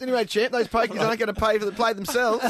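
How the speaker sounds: lightly hammed Australian bloke; mock Aussie bloke. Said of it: "improvising Aussie comment".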